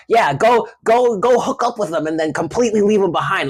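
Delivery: a hint of a friend egging you on to get revenge. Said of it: "friends in your ear that are trying to convince".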